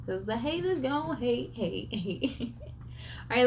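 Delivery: plain reading voice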